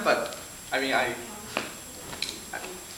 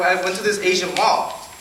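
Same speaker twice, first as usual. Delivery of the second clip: ordinary talk, nothing marked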